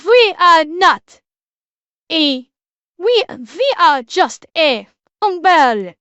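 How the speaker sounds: slow, loud, badly faked Quebecois accent; hammed-up Quebecois French accent, drawn out. Shown as a demonstration. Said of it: "let me see if I can do this accent".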